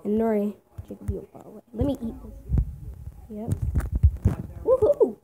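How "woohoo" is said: very high, loud cheer; gleeful whoop. Real, not acted. Player pleased with food from zombies, gleeful. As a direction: whoop in celebration.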